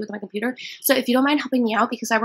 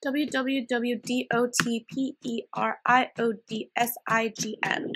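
slow literal spelling; 3 dB quieter, much slower than usual. Acted. Literal caller spells everything slowly.

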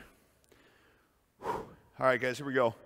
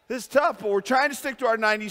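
much higher, louder repeat; emphatic, louder; 7 semitones higher, 5 dB louder, faster than usual. Real, not acted. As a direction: push it out with strain. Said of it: "I mean, this is tough".

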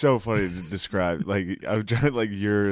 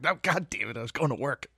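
high-pitched mock protest; exasperated victim; 5 semitones higher, 3 dB quieter than usual. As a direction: play someone haplessly dragged off course. Acts out exasperated blind person.

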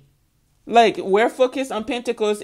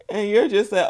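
ordinary delivery

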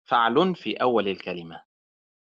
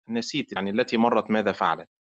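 usual speaking voice

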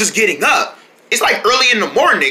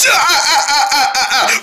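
laughing; 7 semitones higher than usual. Acted. Toy play, laughing voice.

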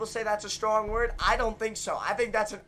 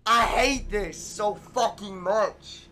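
ordinary talk

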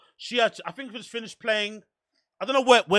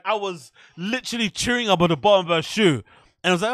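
ordinary talk, nothing marked

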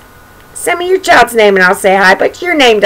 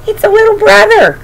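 father bear; 11 semitones higher than usual. Acted. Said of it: "said his father".

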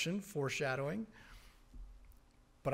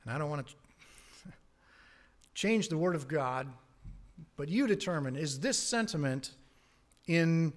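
ordinary talk

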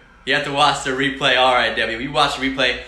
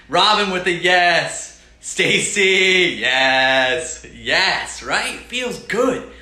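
excited shout-out; 5 semitones higher, 6 dB louder, much slower than usual. Real, not acted. Excitedly reading comment names.

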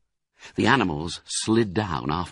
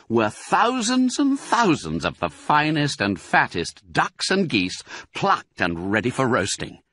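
plain reading voice

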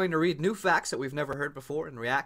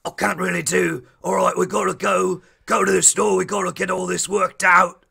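loud, gruff mock Birmingham accent; gruff Brummie gangster, pushed loud; 5 semitones higher, 7 dB louder than usual. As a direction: badly attempt a Peaky Blinders Brummie. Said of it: "gruff like that?".

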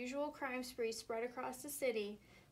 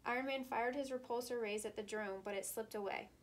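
ordinary delivery

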